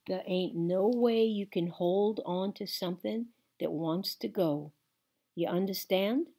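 plain reading voice

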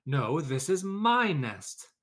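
slow, loud stubborn claim; possessive chicken. Acted. Chicken fights the duck for the nest, slow loud insistent. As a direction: stamp on my. Birds quarrel over nest.

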